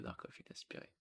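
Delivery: murmured under the breath; whispered aside. Real, not acted. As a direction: repeat it as a whisper to yourself. Répète le commentaire en chuchotant. (chuchoté pour soi)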